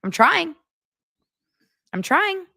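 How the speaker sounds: high-pitched rising protest; small plea shooting up high; mock pleading. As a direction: squeak out a playful excuse. Mock plea after failed accent.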